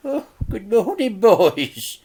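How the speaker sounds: a fussy auntie greeting; Auntie Flip, cheery. A narrator's reading. Auntie Flip's voice.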